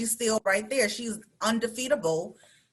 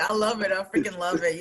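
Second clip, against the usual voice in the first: ordinary talk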